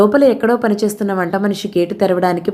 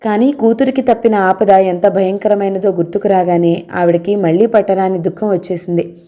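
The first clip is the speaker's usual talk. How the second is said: ordinary delivery, nothing marked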